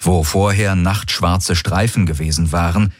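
plain reading voice

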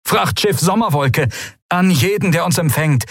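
high, urgent stranger's voice; frightened ship captain calling; desperate distress call. Acted. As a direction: plead for rescue over static.

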